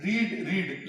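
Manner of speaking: रीड said with a long ee; रीड, long ee like reed; pronounced read. Shown as a demonstration. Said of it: "आप इसे बोलते हैं रीड". (रीड लंबी ई के साथ)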